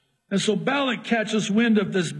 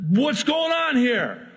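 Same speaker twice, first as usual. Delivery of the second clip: slow demanding Balak; 9 semitones higher, 3 dB louder than usual. Acted.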